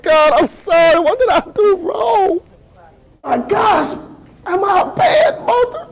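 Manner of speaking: mock tearful pleading, rising in pitch; crying. Mother blaming herself to God, acted crying. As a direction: plead with God through pretend sobs. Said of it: "My mother's crying".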